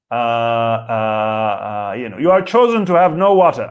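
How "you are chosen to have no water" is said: high, loud God voice in a mock accent; hammy accented decree; God's put-on accent. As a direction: proclaim it as God with a comic accent. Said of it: "I don't know why God has that accent".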